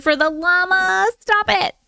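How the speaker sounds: high, delighted thanks for the llama; high, excited thanks; delighted squeal. Real, not acted. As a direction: gush with delight over the gift. Delighted reaction to llama gift.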